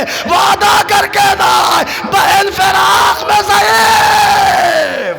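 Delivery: high, loud grieving chant of the tale; wailing lament recitation. Acted. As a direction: cry the scene out to the mourners. Peak chanted wail. (ऊँची आवाज़ में मातमी मरसिया ख़्वानी)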